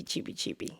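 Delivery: breathy whispered cheepy cheepy; whispered gossip chirp. Retold or imitated. Mimicking gossip while explaining a phrase, whispered.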